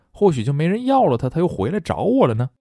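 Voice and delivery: playful wishful daydream; hopeful pretend. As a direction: tease the hope that the ex comes back. Voicing a heartbroken hopeful self.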